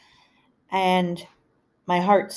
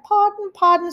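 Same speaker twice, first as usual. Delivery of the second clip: high, repeated puzzled pardon; deaf mom; 4 dB louder than usual. Retold or imitated. Deaf mother not hearing her daughter, repeating pardon. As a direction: keep asking, not hearing. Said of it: "She's like".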